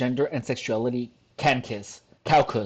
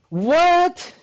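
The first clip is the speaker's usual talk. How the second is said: loud-ish, high rising disbelief; shocked; 13 semitones higher, 4 dB louder than usual. Real, not acted. Viewer finding the video is private, shocked. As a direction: shoot way up in pitch on one stretched word.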